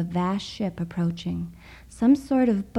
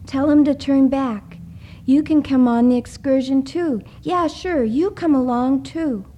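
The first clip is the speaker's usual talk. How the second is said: high, loud frantic pleading; desperate plea; 6 semitones higher, 5 dB louder than usual. A narrator's reading. Douglas begs his family from the boat, frantic. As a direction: beg them to come along.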